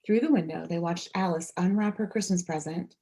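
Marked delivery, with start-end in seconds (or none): none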